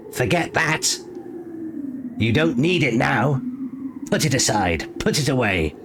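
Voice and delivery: high urgent shrieked orders; shrieking commands. A narrator's reading. Crow shrieks at falling Bran to let it go, high urgent shriek. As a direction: screech each order down at him. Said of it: "it shrieked at him".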